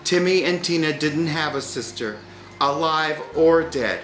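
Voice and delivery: ordinary delivery, nothing marked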